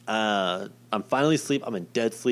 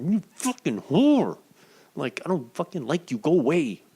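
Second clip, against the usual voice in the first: retold anger; 7 semitones higher than usual. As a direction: act out fuming at the nurse. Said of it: "And I was just mad".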